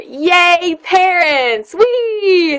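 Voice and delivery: high, drawn-out singsong whoop; playful cheer. Pretend. Reviewer playfully celebrating a clean parents' guide, high gleeful cheer.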